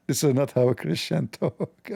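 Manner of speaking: laughing through a mocked quote; derisive laughing. Host laughing at critics' complaint, derisive. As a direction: crack up while parroting the line.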